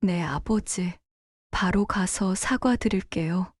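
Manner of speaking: soft, meek reply; chastened daughter. Acted. Daughter promises her father to apologize, soft and submissive. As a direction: yield quietly to father.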